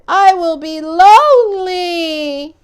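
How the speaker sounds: high, loud, slow sob; tearful wail; crying. Acted. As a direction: weep out the loneliness. Toad lost and alone.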